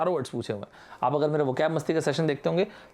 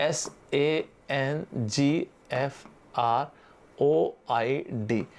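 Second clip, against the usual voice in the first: slow letter-by-letter spelling; dictating a spelling, one letter at a time; much slower than usual. Shown as a demonstration. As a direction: spell each letter slowly and clearly. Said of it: "visible तो बिल्कुल भी नहीं है".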